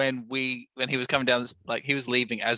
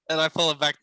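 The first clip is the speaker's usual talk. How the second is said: usual speaking voice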